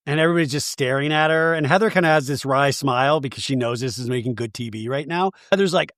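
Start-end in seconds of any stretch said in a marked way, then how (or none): none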